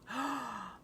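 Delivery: sharp excited gasp; thrilled little gasp. Acted. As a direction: gasp in delight at the news. Boys hear half price.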